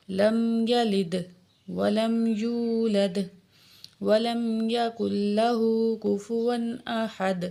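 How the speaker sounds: slow, melodic tajweed chant; Quranic recitation. Shown as a demonstration. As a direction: recite devoutly, drawing out vowels. Recites Surah Ikhlas.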